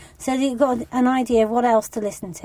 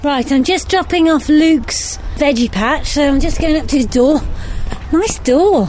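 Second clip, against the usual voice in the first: outdoor recording; 3 semitones higher, 5 dB louder than usual. Real, not acted. Recorded visit on arrival at door.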